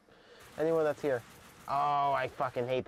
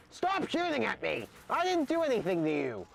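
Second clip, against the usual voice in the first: frustrated yell; 10 semitones higher than usual. Real, not acted. Yelling at players in game.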